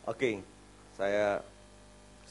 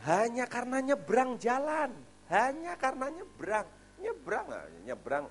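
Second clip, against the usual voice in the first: high, slow anguished repetition; the preacher lamenting the son killed crossing the road, high and slow; 13 semitones higher than usual. Real, not acted. Lamenting the child's death.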